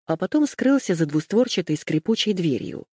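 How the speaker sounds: ordinary delivery, nothing marked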